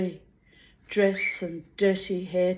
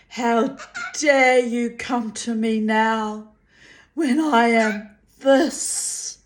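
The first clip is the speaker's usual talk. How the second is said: higher, louder, breaking outcry; anguished fury; 4 semitones higher, 6 dB louder, slower than usual. A narrator's reading. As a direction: hurl it through rising grief. Molly's rising furious grief.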